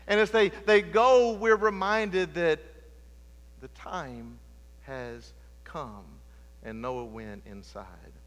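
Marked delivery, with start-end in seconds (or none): 3.6-8.1 soft, low and slow; hushed emphasis. Acted. Preacher repeats the ark moment, hushed slow telling. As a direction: let the words fall quietly. Quiet over music.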